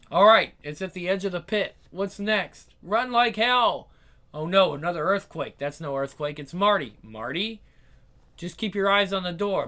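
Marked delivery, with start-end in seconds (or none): none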